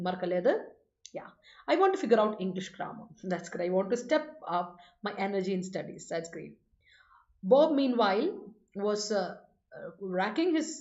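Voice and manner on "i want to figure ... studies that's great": each word set apart, very slowly; slow dictation. Shown as a demonstration. Teacher reading example sentences slowly for students to copy.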